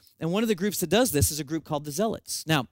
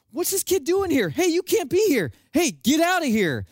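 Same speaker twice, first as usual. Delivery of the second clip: higher, louder mock staff yell at a kid; cast members yelling; 9 semitones higher, 4 dB louder than usual. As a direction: bark at the runaway kid like theme-park staff. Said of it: "The cast members are like".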